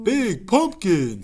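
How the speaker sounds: slow, drawn-out spooky ghost. Acted. Ghost admiring the pumpkin, spooky. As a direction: stretch it like a ghost. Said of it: "said the ghost".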